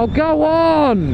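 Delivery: drawn-out, high, pleading "oh, go on"; exasperated plea. Real, not acted. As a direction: beg them to just pass, slowly.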